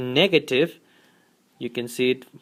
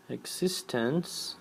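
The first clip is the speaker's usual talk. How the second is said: "Existence." dropped much softer and slow; soft repeated word; 2 semitones lower, 13 dB quieter, slower than usual. Shown as a demonstration. Teacher softly echoing the key word. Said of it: "this expression is for existence".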